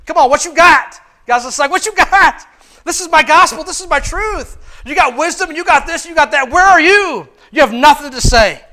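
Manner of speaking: mock-defiant God challenging the wise; God taunting. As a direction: dare them to answer.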